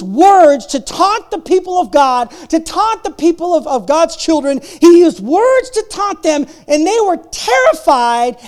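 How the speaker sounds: loud, high, building sermon voice; impassioned preaching. Acted. Pastor retelling Goliath to the church, fired-up preaching. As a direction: preach it like a rallying cry.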